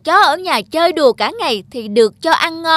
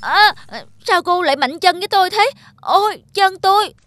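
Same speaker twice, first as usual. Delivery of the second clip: yelp of pain; 7 semitones higher than usual. Acted. Dog kicked by buffalo.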